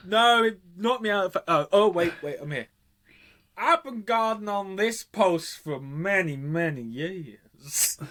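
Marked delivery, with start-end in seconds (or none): 3.6-8.0 slow, drawn-out mock American accent; hammed-up American accent, very slow; terrible American accent. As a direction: draw the words right out in a hokey American accent. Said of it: "our terrible accents".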